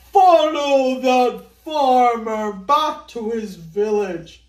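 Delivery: slow, loud commanding order; rich man ordering. Acted. Rich Mister Ao Yang orders his men, slow loud command. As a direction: lay down the order to his bearers. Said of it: "he ordered".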